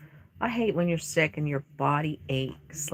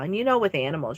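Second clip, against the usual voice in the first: usual speaking voice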